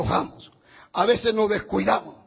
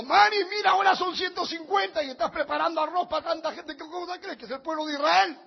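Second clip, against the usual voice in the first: incredulous husband; 6 semitones higher, 3 dB quieter, faster than usual. Retold or imitated. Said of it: "Yo le digo".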